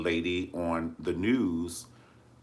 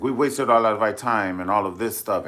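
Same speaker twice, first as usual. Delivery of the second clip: loud, irritated quote; mock the angry prime minister; pissed; 3 semitones higher, 7 dB louder than usual. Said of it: "and he is hot".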